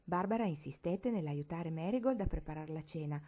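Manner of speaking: plain reading voice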